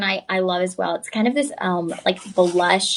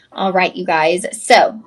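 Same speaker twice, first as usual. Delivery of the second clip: usual speaking voice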